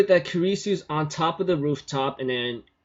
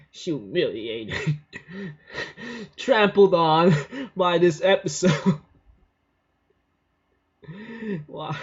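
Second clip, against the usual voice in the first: laughing; 3 semitones higher, slower than usual. Real, not acted. Laughing at own humiliation.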